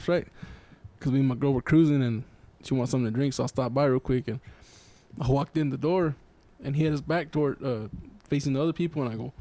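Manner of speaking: ordinary talk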